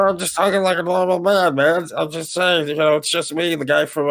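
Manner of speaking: channel a bouncy online personality; YouTuber impression. Doing a YouTuber voice to tease his cohost. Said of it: "it's me, the guy from Oni Plays".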